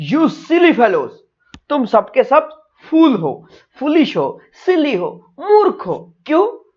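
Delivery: scolding brain. Acted. Brain scolds the other parts. (ऊँची आवाज़ में डाँट)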